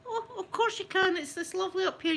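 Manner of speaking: high, sugary reassuring mum; sweet mum voice. Retold or imitated. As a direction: sound lightly sweet to the child. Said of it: "And I went".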